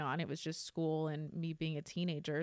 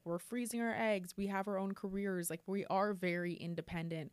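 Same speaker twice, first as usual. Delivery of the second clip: ordinary delivery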